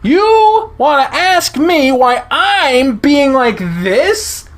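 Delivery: high, loud, slow throwing-back of "Be like this?"; furious retort. Acted. Chase fires Leo's words back.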